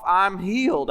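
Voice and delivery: ordinary delivery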